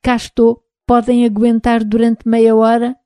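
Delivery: a robot calling in over the radio; half robot voice. Acted. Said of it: "A voz do robô fez-se novamente ouvir". (meia voz de robô)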